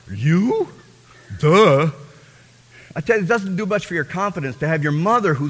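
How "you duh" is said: mock scoff. Mocking paraphrase of mother.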